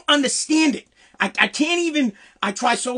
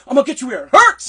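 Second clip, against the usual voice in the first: threat without stutter; 3 semitones higher, 7 dB louder, faster than usual. Retold or imitated. Caddy master threatening a young caddy, loud and stutter-free. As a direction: deliver the threat cleanly, no stammer, louder. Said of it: "he didn't stutter that part".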